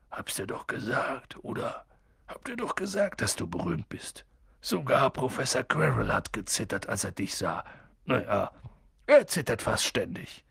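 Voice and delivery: vertraulicher Hagrid. Acted. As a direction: let him in on a secret. Hagrid erzählt Harry leise. (Hagrid, leise und vertraulich zu Harry)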